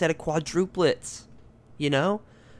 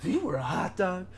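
second try at the announcer voice, slower at the end; Harry Carey impression; 4 semitones higher than usual. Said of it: "Will Ferrell impersonating Harry Carey impression".

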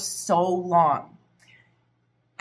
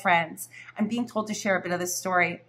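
ordinary talk

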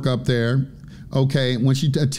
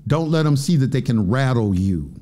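usual speaking voice

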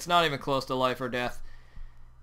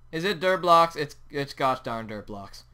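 ordinary talk, nothing marked

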